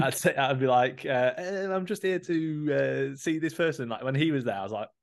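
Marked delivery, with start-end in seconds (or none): 1.4-3.9 high, slow, put-on innocent voice; higher, slower voice, guiltily stalling to the gate guy; awkward fib. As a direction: stall nervously, dragging it out. Fibbing to the guard he knows.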